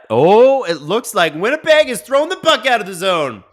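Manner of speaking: high, rising excited call; excited play-by-play. Real, not acted. Live play call, puck cleared.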